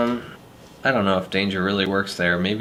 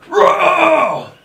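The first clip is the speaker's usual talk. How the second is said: screamed roar, much higher and louder; monster roar; 10 dB louder than usual. Acted. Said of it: "Need more ferocity than that".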